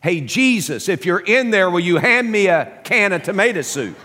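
loud, high call into the dark. Retold or imitated. Little boy calling into the dark pantry, loud and high. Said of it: "he's calling out".

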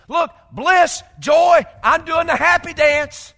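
loud, high burst of joy; exuberant joy. Acted. Acting out joy at facing eternity.

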